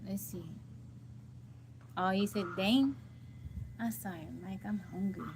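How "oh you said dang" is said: normal talking voice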